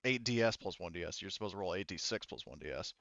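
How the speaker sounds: usual speaking voice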